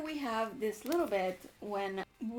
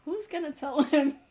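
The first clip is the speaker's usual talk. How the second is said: incredulous; 5 semitones higher, 9 dB louder than usual. Acted. Demigods' incredulous look.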